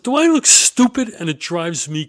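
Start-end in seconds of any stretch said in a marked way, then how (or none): 0.0-1.1 loud and high, fed up; indignant outburst. Real, not acted. Fed up with being lied to, loud indignant challenge. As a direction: throw it out like an insult to your intelligence. Exasperated rhetorical catchphrase.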